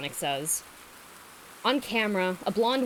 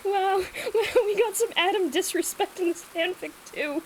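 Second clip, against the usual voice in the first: much higher, delighted exclaim; excited; 10 semitones higher than usual. Real, not acted. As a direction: squeal with amused delight. Reacting with delight.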